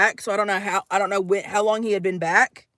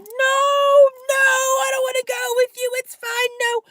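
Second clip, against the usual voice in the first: very high, wailing protest; screaming; 14 semitones higher than usual. Retold or imitated. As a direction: shriek a panicked refusal, acted out. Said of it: "I'm actually screaming right now".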